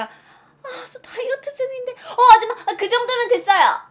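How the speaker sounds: squeaky, panicked polite refusal; flustered refusal. Acted. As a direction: protest pleadingly as the scoop piles up. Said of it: "그럼 제가".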